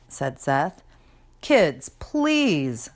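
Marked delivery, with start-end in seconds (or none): 1.4-2.9 higher, drawn-out weary plea; tired. A narrator's reading. Mom pleads with her squabbling kids, tired. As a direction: plead wearily, stretching please. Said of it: "Seth's mom sounded tired".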